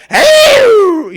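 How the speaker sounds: loud high shout. Acted. Calling out to get attention, loud high shout. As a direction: yell it out sharply.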